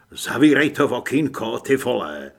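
loud command, falling off at the end; narrated yell. A narrator's reading. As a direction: yell it as an urgent order, moderately. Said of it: "zařval jsem".

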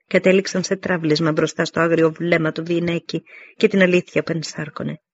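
ordinary delivery

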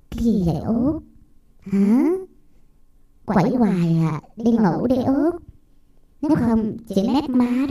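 lightly voiced scolding older sister; bossy big sister telling off. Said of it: "chị Hai à, nói nghe coi". (giọng chị Hai mắng em)

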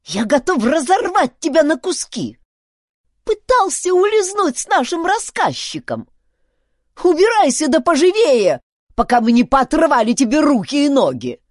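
high, loud squealed threats; irate chief's piping tirade; furious squeaking. A narrator's reading. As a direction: threaten violence in a squeaky rage. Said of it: "пропищал он".

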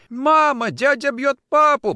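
put-on very high, loud child's wail; crying child. A narrator's reading. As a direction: wail for mother in terror. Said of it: "плакал ребёнок".

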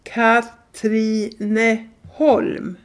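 very slowly, every syllable spelled out; saying a town name very slowly so a stranger will understand; slow and clear. Retold or imitated. Stretching out the town name slowly and carefully. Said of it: "så tydligt och långsamt jag kunde".

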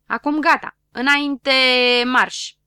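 loud, high march order; marching command. Acted. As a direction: send them off like a drill sergeant. (comandă de marș, tare)

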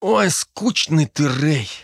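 slow, high, drawn-out sigh of boredom; sighing complaint. A narrator's reading. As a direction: sigh it out slowly at a dull friend. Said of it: "Дарт вздохнул". (протяжно, со вздохом)

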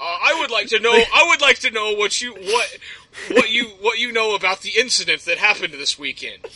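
stern mock-official demand, halting restarts; condescending officer. Commanding officer questioning the guard about the weekend incident, condescending. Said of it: "talking to me like I'm a child".

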